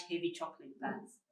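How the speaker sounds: ordinary talk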